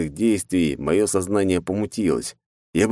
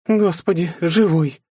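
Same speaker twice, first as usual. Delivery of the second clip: higher, relieved breathless joy; relieved exclaim; 11 semitones higher, 3 dB louder, slower than usual. Acted. Kitsune gasps seeing the hero alive. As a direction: gasp with relief. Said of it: "выдохнула она".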